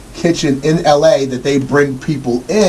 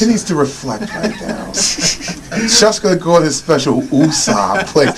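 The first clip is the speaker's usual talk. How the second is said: mocking through laughter. Mocking the chef needing calm.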